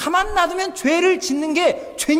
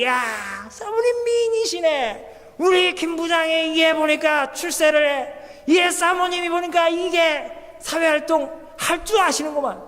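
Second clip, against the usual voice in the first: put-on pitched-up drunken flattery; tipsy boss flattering; 4 semitones higher than usual. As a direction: ham up a drunk boss charming the hostess. Drunk boss praising wife.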